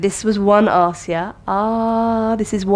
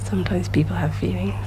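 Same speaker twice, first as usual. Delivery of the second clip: whispering; 6 dB quieter than usual. Real, not acted. Teacher aside to class.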